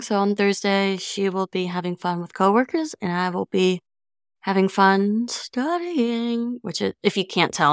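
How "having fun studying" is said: drawn-out mock enthusiasm, slow and higher; sarcastic. Real, not acted. Student comparing her study day with her twin's party, sarcastic. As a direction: stretch it out in fake cheer, dripping sarcasm. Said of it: "I'm being so sarcastic".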